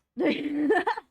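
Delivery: loud, high burst of laughter; real laughter. Real, not acted. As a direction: burst out laughing at your own thumbnail. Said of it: "I'm laughing at my thumbnail".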